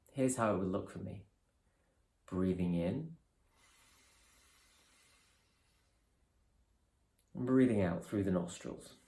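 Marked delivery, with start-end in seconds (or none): 2.3-8.9 calm, measured guidance with long pauses; guided meditation. Real, not acted. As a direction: ease them through each breath, unhurried and softening. Leads a breathing meditation.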